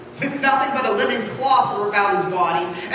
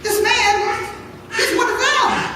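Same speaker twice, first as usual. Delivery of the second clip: much higher, tattling tone; pointed accusation; 10 semitones higher than usual. Acted. Maid telling bystanders Peter is a follower, high-pitched. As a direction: point him out to everyone.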